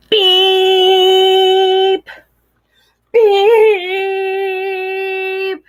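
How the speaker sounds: loud long honking beep like a tractor horn. Acted. Reading the tractor scene, loud horn beep. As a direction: blast it out like a horn.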